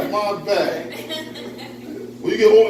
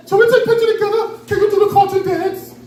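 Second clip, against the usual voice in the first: high-pitched mock German accent; German tourist accent; 12 semitones higher than usual. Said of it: "That's how they talk".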